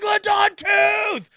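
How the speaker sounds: put-on high, slow thrilled kid shout; kid screaming. Dad imitating his son yelling to his mom. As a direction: yell it at his mom, ecstatic. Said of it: "he starts screaming at me".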